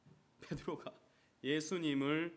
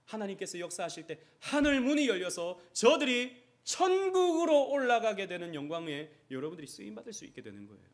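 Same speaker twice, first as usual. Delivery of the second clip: higher and louder, then easing back; fervent preaching; 6 semitones higher, 11 dB louder than usual. Acted. Pastor promising heaven opens, fervent preaching. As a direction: lift the church with the promise.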